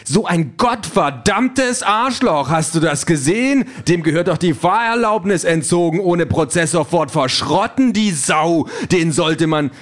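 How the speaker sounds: furious cursing. Acted. Car Herbert rages at the sports car that cut him off, shouting. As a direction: explode at the reckless driver. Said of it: "Herbert bremst und flucht".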